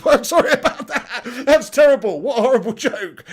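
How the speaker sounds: high, loud, through real laughter; helpless laughing while saying sorry; laughing apology. Real, not acted. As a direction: crack up at your own awful joke. Cracking up at own bad joke.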